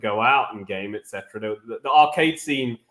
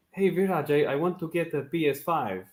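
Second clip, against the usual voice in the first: a light take on the neighbor's way of talking; imitating the neighbor; 5 dB quieter than usual. Telling the panel how his neighbor asked him, lightly imitating the neighbor. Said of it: "Is how he talks".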